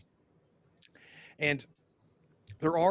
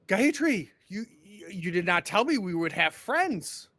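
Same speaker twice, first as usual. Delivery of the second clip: cheery mayor; 5 semitones higher, 6 dB louder than usual. Acted. GM voices mayor George greeting.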